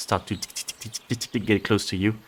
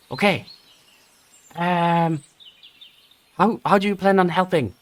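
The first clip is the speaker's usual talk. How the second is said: high, sharp goblin voice, probing; a wary goblin testing the visitors; 9 semitones higher, 4 dB louder than usual. Acted. Goblin asks how the party will help, high goblin voice.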